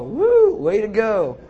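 high, loud mocking whoop of a cheer; sarcastic cheer. Preacher mocking the rejected boy with a loud sarcastic whoop. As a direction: cheer him on, dripping with sarcasm.

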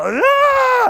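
sharp high yell to startle; scaring shout. Retold or imitated. Pastor yelling to scare the cat from the pool, high sharp yell. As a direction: bark out a startling "Ah!". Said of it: "I can be all".

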